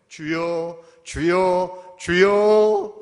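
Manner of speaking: loud, rising shouted plea to the Lord; desperate shouted prayer, drawn out at the end; shouted cry. Shown as a demonstration. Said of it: "소리 지르는 정도가 아니에요".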